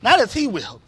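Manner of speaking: rising fervent sermon peak; full-force preaching. Real, not acted. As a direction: drive it home to the congregation.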